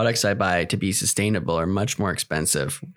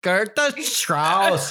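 Juno quote; 7 semitones higher, much slower than usual. Retold or imitated. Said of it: "when she's like".

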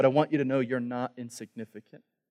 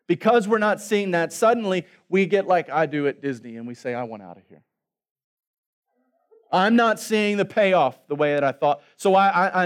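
louder, higher preaching climb; preaching at full force; 4 semitones higher, 6 dB louder than usual. Acted. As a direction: build up the fire of the sermon.

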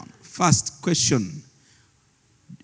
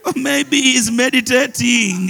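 mocking taunt; 6 semitones higher than usual. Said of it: "Elijah began to mock them".

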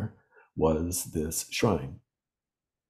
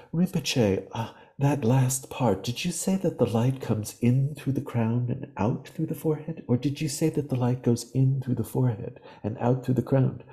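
higher, prim and formal translator's voice; 6 semitones higher than usual. Retold or imitated. Translator politely asks the Rinpoche for clarification, formal and higher. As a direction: ask precisely, like a boarding-school translator. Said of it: "she had kind of a very formal voice".

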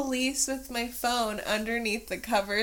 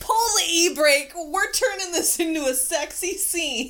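loud mock dramatic; 7 semitones higher, 8 dB louder than usual.

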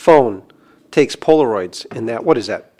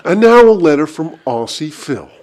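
louder radio countdown host voice; Casey Kasem; 5 dB louder than usual. Retold or imitated. Introducing the listener letter like Casey Kasem, radio style. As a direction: announce it like a smooth radio DJ. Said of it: "You know how Casey Kasem reads letters?".

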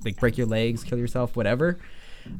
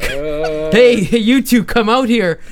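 loud, high-pitched command; acted shouted order; 8 semitones higher, 8 dB louder, much slower than usual. Hooded men ordering two campers out of the tent, acted shout. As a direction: shout it like a threat to come out.

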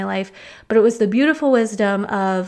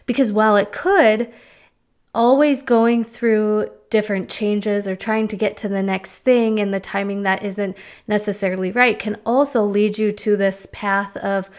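usual speaking voice

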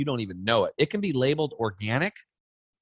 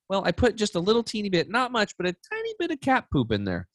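sweetly sneaky mom; 9 semitones higher than usual. Retold or imitated. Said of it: "mom reveals".

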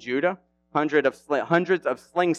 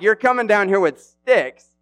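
louder, higher jeer; Goliath jeering at David, raised and high; mocking giant; 7 semitones higher, 4 dB louder than usual. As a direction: sneer it at him. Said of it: "he's like".

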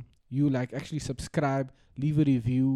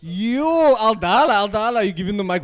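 loud call; 7 semitones higher, 11 dB louder than usual. Real, not acted. Calling out to shy friend.

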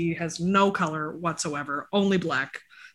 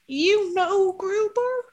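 high dog-talk voice; 13 semitones higher, much slower than usual. Real, not acted. Calling the dog by name.

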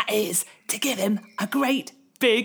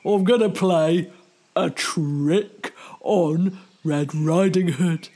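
sly wolf; 5 semitones lower, slower than usual. Acted. Wolf plotting a trick.

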